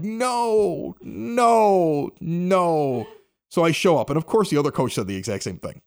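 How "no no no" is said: drawn-out, stepping-down noes; mock despairing protest; dismayed refusal. Retold dismay at rain forecast.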